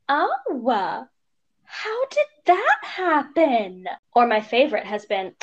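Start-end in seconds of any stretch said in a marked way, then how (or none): 0.1-3.9 high, slow mock-nosy voice; drawn out like a nosy busybody; nosy stranger. Mother retelling nosy strangers in a high slow mock voice.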